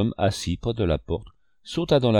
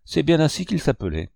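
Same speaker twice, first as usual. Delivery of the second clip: higher-pitched, mildly agitated confirmation; 7 semitones higher than usual. A narrator's reading. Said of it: "reprit John Silver très agité". (agitation modérée, voix plus haute)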